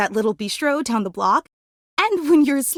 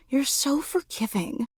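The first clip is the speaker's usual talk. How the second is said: ordinary delivery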